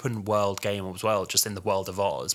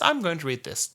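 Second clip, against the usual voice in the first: high put-on announcing voice; imitated sketch character; 8 semitones higher than usual. Character picks up the Bible, high imitated announcement. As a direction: mimic a comedy character, pitched well up. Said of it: "So the character then picks up".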